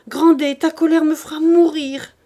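high, loud wail of reproach; distressed wife. A narrator's reading. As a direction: cry out in anguish at a husband's rage. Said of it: "dit la pauvre femme".

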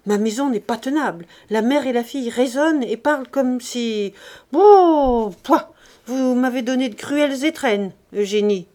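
loud outburst with a disgusted pouah; shouting. Acted. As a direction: storm out yelling. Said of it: "cria-t-il". (crier de colère en partant)

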